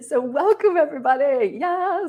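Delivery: high-pitched, celebratory cheer; excited welcome. Real, not acted. Host kicks off day one for everybody, excited welcome. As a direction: lift the voice high to welcome the crowd.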